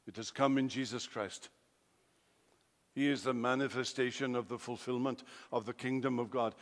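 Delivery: normal talking voice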